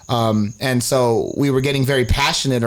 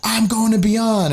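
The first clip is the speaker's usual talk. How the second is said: put-on drunk announcement; 10 semitones higher, slower than usual. Drunk friend announcing festival plan.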